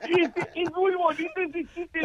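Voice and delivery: laughing at his own joke. Real, not acted. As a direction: laugh out the words.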